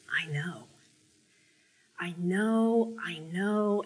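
soft and hushed, repeating it gently; tender reassurance. Acted. Jesus softly comforting a weary, ashamed woman, tender and hushed. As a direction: hold her close and soothe her with each I know.

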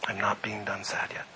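breathy whisper, almost to himself; whispered confession. Real, not acted. Pastor confessing his grief to the church, whispered. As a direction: let it slip out in a whisper. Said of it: "That's me".

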